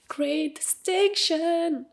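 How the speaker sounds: drawn-out, high, proud announcement; stretched-out triumphant flourish; proud proclamation. Real, not acted. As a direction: sing out the honour slowly and proudly. Proudly proclaims her graduation honour.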